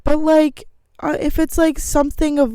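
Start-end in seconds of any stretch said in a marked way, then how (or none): none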